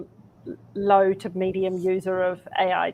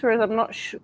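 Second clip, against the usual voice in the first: ordinary delivery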